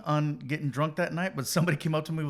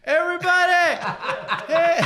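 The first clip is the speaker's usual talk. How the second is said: high, loud hype call into a fake mic; bottle-as-mic announcer shout; mock mic shout; 7 dB louder than usual. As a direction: yell it like an MC. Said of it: "talking into the bottle like it's a mic".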